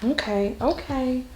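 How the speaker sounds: calming a fussy newborn; soothing. Real, not acted. Mother soothing a crying baby.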